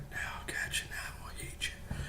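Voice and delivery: a faint whisper from far off; barely audible far-off whisper. Acted. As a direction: breathe it like it's rooms away. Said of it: "got further away".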